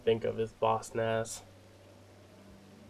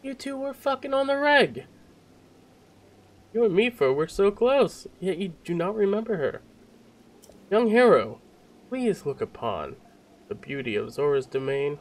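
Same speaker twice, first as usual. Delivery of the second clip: higher, incredulous royal reproach; hammed-up monarch, pitched up; dismayed theatrical king; 8 semitones higher, 4 dB louder than usual. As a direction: plead like a king shocked at an old friend. Voices the king's dialogue.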